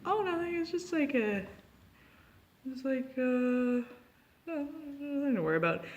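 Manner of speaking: high, squeaky mock-innocent voice; innocent denial. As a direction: feign innocence in a high little voice. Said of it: "I was like".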